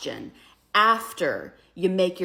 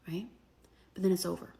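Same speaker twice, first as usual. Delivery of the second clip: ordinary talk, nothing marked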